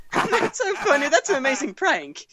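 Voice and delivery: high put-on British accent. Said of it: "I should put in my British accent".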